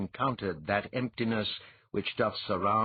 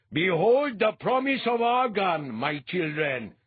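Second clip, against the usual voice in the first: high, loud, booming prophet voice; a fanatic priest calling out to the masses, loud and high; thundering priest; 9 semitones higher, 6 dB louder than usual. A narrator's reading. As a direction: proclaim it to the crowd like a zealot. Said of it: "Behaid thundered".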